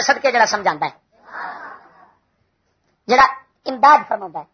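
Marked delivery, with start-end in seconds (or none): none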